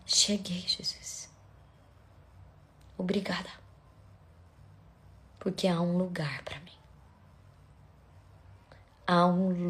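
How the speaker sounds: soft, half-whispered devotion; hushed prayer. Real, not acted. Preacher opens by praying softly to Jesus, hushed prayer.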